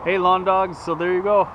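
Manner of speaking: loud, upbeat address to viewers; vlog host voice. Real, not acted. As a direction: greet the audience brightly.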